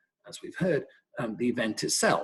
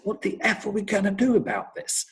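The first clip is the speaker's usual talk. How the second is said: retold furious outburst; 8 semitones higher than usual. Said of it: "And he started swearing".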